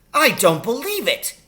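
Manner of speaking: high, loud disbelieving cry; outraged disbelief; cried out. A narrator's reading. Doctor crying out on hearing about the pit. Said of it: "he cried".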